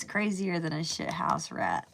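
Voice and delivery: ordinary delivery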